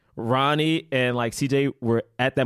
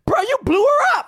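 very high, loud mock disbelief; shocked outcry; 5 dB louder than usual. Zac Efron's character reacting to her being blown up, shocked outcry. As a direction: yelp it high in comic shock.

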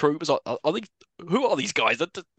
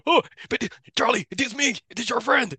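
pleading friend; 8 semitones higher, 4 dB louder than usual. Retold or imitated. Voicing Blanca's friend pleading.

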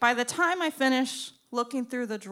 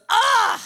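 loud, high "ugh"; disgusted scoff; 11 semitones higher, 10 dB louder than usual. Retold or imitated. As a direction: imitate a rude, disgusted groan. Stranger looks her over with disgust.

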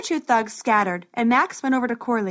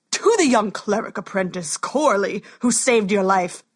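booming warrior king; 3 dB louder than usual. Acted. King addresses Corley.

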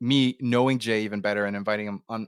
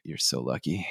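put-on envious teenager; 10 semitones lower, 6 dB quieter than usual. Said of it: "I'm like".

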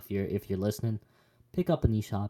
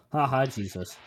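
mock gotcha; 3 semitones higher than usual. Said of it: "trying to trick Jesus".

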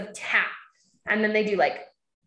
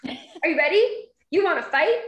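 put-on playful kid challenge; 6 semitones higher than usual. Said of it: "she goes".

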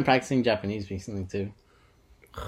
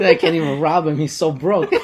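laughing quote; 4 semitones higher, 6 dB louder than usual. Retold or imitated. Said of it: "he's like".

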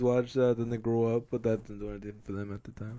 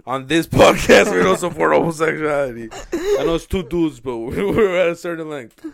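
laughing; 4 semitones higher, 10 dB louder than usual. Pretend. Mock announcement, laughing loudly.

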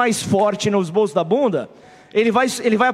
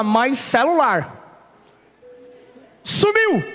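slow broken English; 2 semitones higher, much slower than usual. Retold or imitated. Lost phone, trying broken English.

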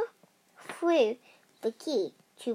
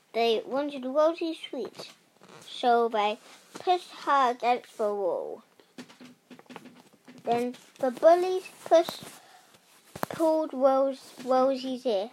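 ordinary delivery

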